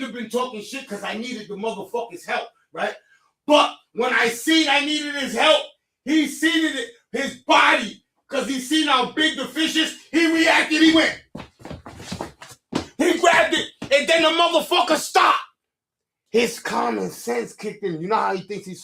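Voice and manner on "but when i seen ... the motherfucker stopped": raised, higher, louder telling; telling the crowd how his brother froze, worked up and loud; excited retelling. Real, not acted. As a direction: pile it on, worked up. Heated retelling of the catch.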